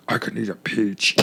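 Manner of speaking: deep, soft impression; attempted Nick Cage. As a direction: drop the voice right down to imitate. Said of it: "I'm gonna continue doing".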